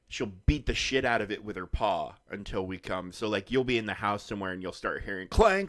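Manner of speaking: ordinary talk, nothing marked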